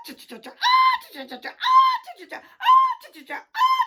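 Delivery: shrieking high hen cackle; hen's panicked cackle, very high and loud; loud hen squawking. Acted. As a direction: squawk like a frantic laying hen. Hen clucking loudly before laying.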